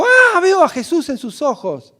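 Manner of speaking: high, loud, slow astonished cry; startled shopkeeper shouting with wonder; excited cry. Retold or imitated. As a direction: cry out as if you had just seen Jesus. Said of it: "de repente tuvo un grito y dijo".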